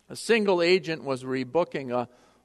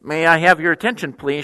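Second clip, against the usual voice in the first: louder, brisk PA announcement; public address announcement; 8 dB louder than usual. Retold or imitated. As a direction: call it out over the terminal speakers. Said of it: "she broadcast throughout the terminal".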